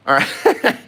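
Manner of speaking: high, loud words breaking into laughter; laughing. Real, not acted. Painter to stream viewers, cracking up mid-lesson. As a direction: try to go on but crack up. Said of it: "I just cracked myself up".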